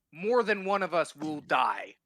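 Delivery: emphatic. Real, not acted. Player stressing danger.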